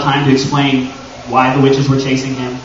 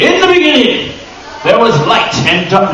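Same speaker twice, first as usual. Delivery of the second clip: mock epic narrator; 6 semitones higher than usual. Mock epic history opener.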